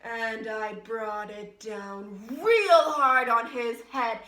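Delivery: slow, loud, forceful telling; building rage. Acted. Woman reliving hitting the shopper, slow and loud with rage. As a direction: swell into fury, stretching the words. Acting out the violent blow.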